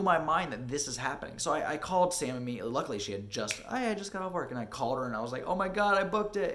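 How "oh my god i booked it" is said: higher, louder retold thrill; excited announcement. Actor calling partner about booking it, excited announcement. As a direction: burst out with the big news.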